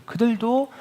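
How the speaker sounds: usual speaking voice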